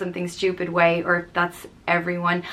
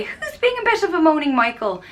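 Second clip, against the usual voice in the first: put-on teasing the kids; 9 semitones higher than usual. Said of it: "I'd say".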